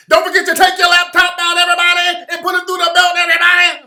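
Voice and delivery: high, very loud shouted hype-man voice; screaming crispy character voice; crispy voice yell. Retold or imitated. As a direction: bellow it like a hyped-up character who won't quit. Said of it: "yelling in the crispy voice".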